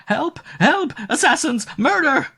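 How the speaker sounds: very high, loud shrieks for help; terrified shriek. A narrator's reading. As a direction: scream as if being murdered. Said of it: "she shrieked".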